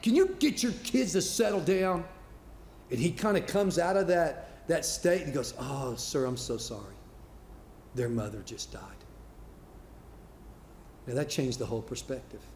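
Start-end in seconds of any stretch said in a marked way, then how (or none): 0.0-2.0 sharp raised voice at the dad; retold shout. Older man on the train snaps at the dad. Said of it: "he shouts at the guy". 5.6-8.8 hushed, much softer apology; quiet grief. Retold or imitated. Grieving dad answers the frustrated older man softly.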